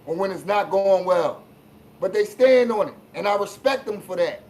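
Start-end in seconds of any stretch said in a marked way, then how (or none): none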